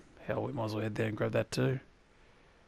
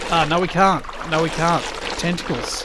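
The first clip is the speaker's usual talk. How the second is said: alarmed; 6 semitones higher, 7 dB louder than usual. Real, not acted. Tentacles attack in the water.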